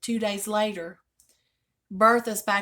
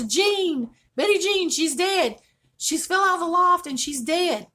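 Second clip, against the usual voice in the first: high, loud frantic report; frantic child; 9 semitones higher, 7 dB louder than usual. Retold or imitated. Child telling her mother the news in a panic. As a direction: blurt it out in a panic. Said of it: "And she goes".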